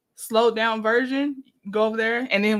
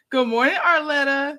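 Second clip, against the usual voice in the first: usual speaking voice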